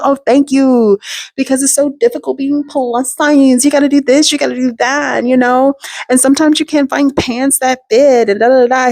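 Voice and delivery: high-pitched, gushing small talk; chatty polite reply. Retold or imitated. Retells her own chatty polite reply.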